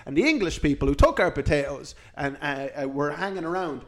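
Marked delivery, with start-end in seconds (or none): none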